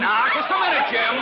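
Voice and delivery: angry protest. Acted. Father objects to the insult about his son, angry. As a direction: stop him short, indignant.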